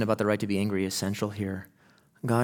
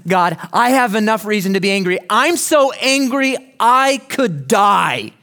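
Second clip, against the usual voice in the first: high, loud indignant outburst; angry Jonah; 9 semitones higher, 7 dB louder than usual. Retold or imitated. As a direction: spit it back at God in a rage. Jonah's angry reply voiced.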